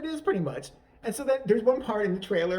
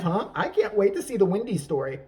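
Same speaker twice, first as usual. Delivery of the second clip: usual speaking voice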